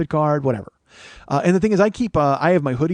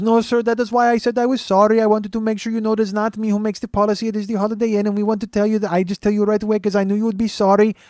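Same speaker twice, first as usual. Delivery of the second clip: put-on high-pitched Indian-accented clerk; put-on Indian accent, pitched up, explaining; 8 semitones higher, 3 dB louder than usual. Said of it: "he's got that, uh, accent".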